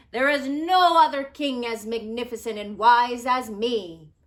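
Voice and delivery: smug royal bragging; boastful king. Acted. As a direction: brag like the grandest king alive. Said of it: "he boasted".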